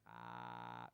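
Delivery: low croaky drone like a didgeridoo; croaky morning voice. Shown as a demonstration. Performer shows the audience the didgeridoo sound, croaky and low. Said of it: "croaky voice in the morning".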